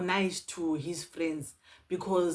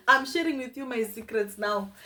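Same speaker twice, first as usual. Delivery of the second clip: laughing; 6 semitones higher, 3 dB louder than usual. Real, not acted. Laughs while teasing viewers.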